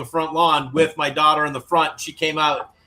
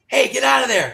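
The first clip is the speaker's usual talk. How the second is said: higher, raised-voice call to shoo an animal; retold shout; 9 semitones higher, 4 dB louder than usual. Camper shooing a bear from the window, retold shout. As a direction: re-enact yelling out the window.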